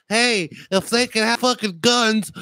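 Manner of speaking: high, mocking little girl voice; a hammed-up schoolgirl taunt; mock liberal girl. Mocking liberal schoolgirls, in a high taunting voice.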